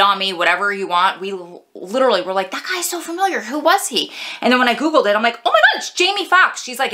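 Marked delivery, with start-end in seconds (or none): 2.5-4.1 mock confused. Retelling confusion with sister. 5.5-6.6 mock surprise. Retelling discovery while googling.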